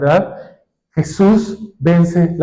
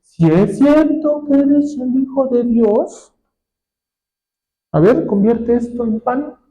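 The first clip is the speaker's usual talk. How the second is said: a slow taunting devil, pitched higher; mocking devil; 4 semitones higher, slower than usual. Preacher voices the devil taunting Jesus with doubt. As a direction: sneer the challenge like the tempter. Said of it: "Está diciendo".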